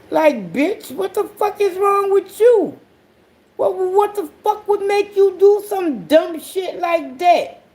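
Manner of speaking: high-pitched what-the-hell rant; exasperated outburst. Real, not acted. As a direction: scold him like he's lost his mind. Scolding son in disbelief.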